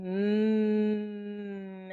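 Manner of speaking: "mm" as a long hummed M, lips closed; M sound. Shown as a demonstration. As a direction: hold "mm" as a steady hum through the nose. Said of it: "turn your voice on".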